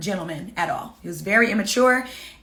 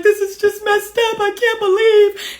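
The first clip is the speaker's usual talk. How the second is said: much higher, imitating a tearful friend; friend crying; 10 semitones higher, 5 dB louder, slower than usual. Coaching sister on the phone cries for her, imitated tearful. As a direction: mimic the friend's crying lament. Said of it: "was boohoo crying".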